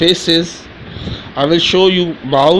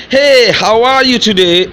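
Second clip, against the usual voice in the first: higher, bright greeting to a friend; friendly greeting; 8 semitones higher, 3 dB louder than usual. Shown as a demonstration. Teacher demonstrating meeting somebody, a cheerful hey. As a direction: greet someone warmly. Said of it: "you say".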